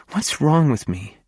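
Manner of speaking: high, pleading question through tears; tearful. A narrator's reading. Stella to him, asking tearfully. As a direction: voice catching as she asks. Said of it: "says through her sniffles".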